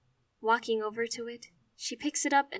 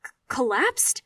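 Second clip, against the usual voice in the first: shocked; 4 semitones higher, 9 dB louder than usual. Acted. Stunned by news.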